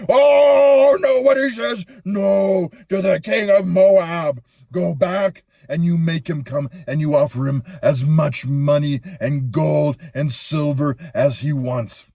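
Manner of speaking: a king throwing a tantrum at his messengers; furious shouting king. Acted. King of Moab throws a fit.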